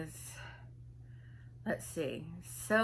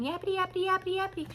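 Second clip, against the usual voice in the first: high, even drone of yapping; returning yappity chatter; 10 semitones higher, much faster than usual. Retold or imitated. She comes back into the room, mock yapping. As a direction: yap steadily on a raised pitch. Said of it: "then she would come back in".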